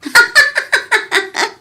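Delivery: mocking laugh. Mocks the ex pleading for love.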